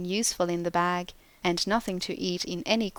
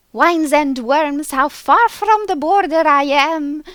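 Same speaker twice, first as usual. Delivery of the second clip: chattering nonsense; 8 semitones higher, 11 dB louder than usual. A narrator's reading. Winged creatures whirling round the clerk, high chatter. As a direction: chatter gleefully around him. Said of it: "chattering ... perfect nonsense".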